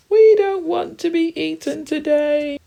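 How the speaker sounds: very high, drawn-out wail; loud cry. Acted. Three frightened friends refusing to be eaten, crying loudly. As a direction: wail it out at the top of the voice. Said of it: "cry the three friends loudly".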